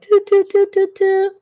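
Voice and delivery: high, even "doo" alarm beeps; mock alert signal on one high note; imitated antenna beeps. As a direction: beep like little antennas going up. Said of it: "putting up those little antennas like".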